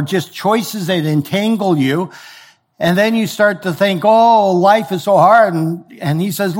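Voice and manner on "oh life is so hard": a higher, louder, slow self-pitying whine; hammed-up moaning about life; mock whine. Believer complaining.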